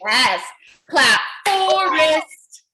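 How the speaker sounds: ordinary talk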